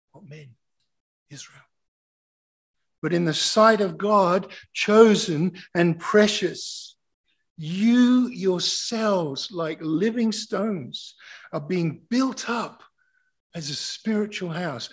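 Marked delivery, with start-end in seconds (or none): 3.0-14.9 slow, loud, raised-pitch proclamation; slow reading. Real, not acted. Preacher reading Peter to the church, slow and forceful. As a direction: proclaim each phrase slowly at full preaching force. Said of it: "gonna read this really slowly".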